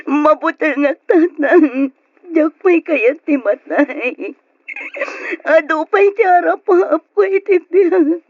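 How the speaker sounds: loud, drawn-out wail; anguished sobbing. Acted. Daughter telling father she isn't ready to die, wailing. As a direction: cry out desperately, dragging the final words.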